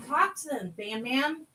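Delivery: ordinary delivery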